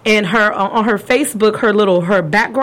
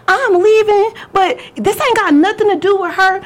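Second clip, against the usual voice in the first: high-pitched, mouthy retort; defiant ex, acted; 9 semitones higher than usual. Ex exposing him as she leaves, high defiant mimicry. Acting out ex's angry parting shot.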